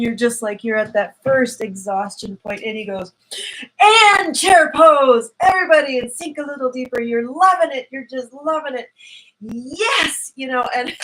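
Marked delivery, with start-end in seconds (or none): 3.8-10.3 excited yoga teacher. Retold or imitated. Imitating the yoga teacher cheering the class through chair pose, excited. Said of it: "he gets really excited".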